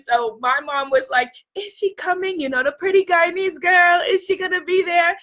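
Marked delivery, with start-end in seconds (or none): 1.5-5.2 high-pitched, badly faked Guyanese accent; terrible Guyanese accent. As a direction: imitate Mom with a bad Guyanese accent. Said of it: "That was a terrible Guyanese accent".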